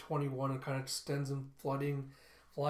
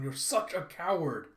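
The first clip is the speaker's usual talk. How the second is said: angry accusation; 3 semitones higher, 5 dB louder, slower than usual. Retold or imitated. Daughter accuses father in quarrel.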